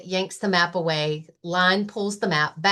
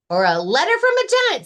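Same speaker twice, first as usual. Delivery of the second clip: high, loud, fast bunny voice; excited Rabbit; 10 semitones higher, 7 dB louder, much faster than usual. Acted. Rabbit guessing about the map to Lion, high and fast. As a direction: blurt it out breathlessly.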